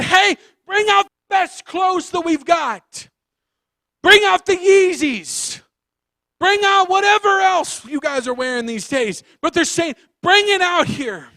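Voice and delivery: high, loud orders, slowed for effect; excited yelling. Acted. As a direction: yell the orders across the house. Parents yell for clothes.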